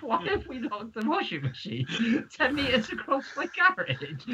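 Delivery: high voice, laughter breaking in; incredulous, half laughing; laughing disbelief. Retold or imitated. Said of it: "And I was like".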